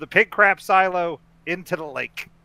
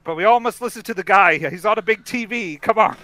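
excited; 6 semitones higher, 8 dB louder, faster than usual. Real, not acted. Hyping the big TV scene.

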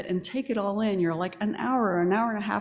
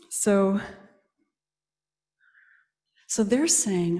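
ordinary talk, nothing marked

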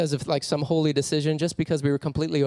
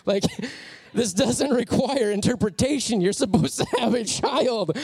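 laughing friend; 8 semitones higher than usual. Retold or imitated. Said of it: "he starts laughing".

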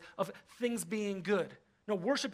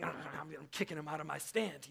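grumpy mutter; 6 semitones lower, 9 dB quieter than usual. Retold or imitated.

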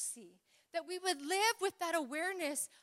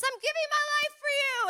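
high, loud shouted cry; shouting; 13 semitones higher, 5 dB louder than usual. Retold or imitated. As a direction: yell it out as he jumps. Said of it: "Shouting that he gave his life for me".

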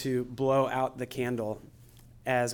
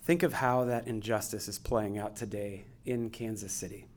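guided meditation; 8 dB quieter than usual. Acted. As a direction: gently guide them into stillness. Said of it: "I'm gonna take us through a guided meditation".

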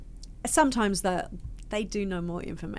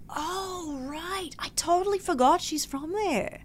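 much higher, oh-right realization; mock surprise; 11 semitones higher than usual. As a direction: play the casting director suddenly remembering. Casting directors caught forgetting.

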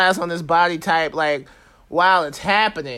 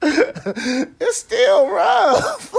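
drawn out, high, slow through laughter; laughing; 10 semitones higher, much slower than usual. Real, not acted. Laughing at his own bar loneliness, drawn out high through laughter. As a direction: squeeze the words out while laughing.

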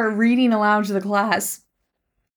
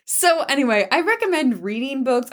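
usual speaking voice

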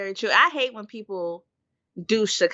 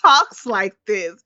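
exasperated; 6 semitones higher, 4 dB louder, much slower than usual. Retold or imitated. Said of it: "cause I'm like".

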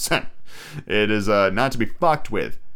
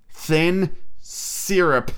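usual speaking voice

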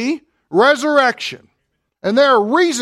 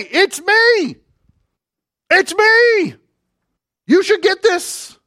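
higher, louder, pleading It's me; insistent Jesus; 10 semitones higher, 4 dB louder, slower than usual. Acted. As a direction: press it home as Jesus begging to be recognised. Jesus insisting to doubting disciples.

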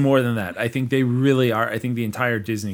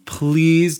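PLEASE drawn out, higher and louder; pleading; 6 semitones higher, 6 dB louder than usual. Real, not acted. Host appeals to Disney executives, pleading on "please". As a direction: lean hard on a long, begging "please". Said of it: "my ask of you".

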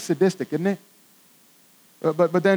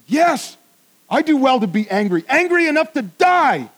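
sulky, higher outburst rising on angry; angry Jonah; 7 semitones higher, 3 dB louder than usual. Acted. Jonah answers God's question, indignant and sulky. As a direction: snap back at God like a sulking prophet.

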